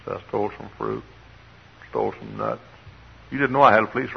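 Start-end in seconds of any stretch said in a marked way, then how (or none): 0.0-2.6 soft, halting admission; sheepish confession. Retold or imitated. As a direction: own up quietly, bit by bit. Son confesses to mother, retold.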